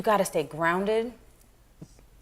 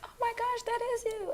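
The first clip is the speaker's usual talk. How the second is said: much higher, starstruck squeal; excited fan. Retold or imitated. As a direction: gasp it out like a fan spotting a star. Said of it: "she was like".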